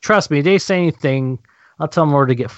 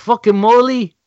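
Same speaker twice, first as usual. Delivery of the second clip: slow, heavy Boston accent demo; 7 semitones higher, slower than usual. Shown as a demonstration. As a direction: show them the Boston accent, slowly. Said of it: "Like that".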